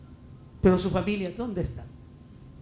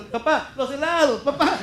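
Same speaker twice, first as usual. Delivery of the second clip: put-on high, eager kid calling Dad; excited child calling; 7 semitones higher, 3 dB louder than usual. Child calling papá when the ice-cream cornet sounds, excited imitation.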